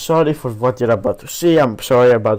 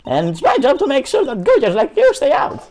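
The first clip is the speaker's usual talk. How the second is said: put-on shrill high old woman, very high; bossy old security lady yelling; 11 semitones higher than usual. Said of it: "Stop the old lady voice".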